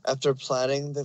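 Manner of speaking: plain reading voice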